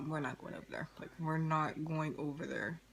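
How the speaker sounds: low, hushed retold refusal; gedämpfte Absage. Retelling friend's reluctant refusal.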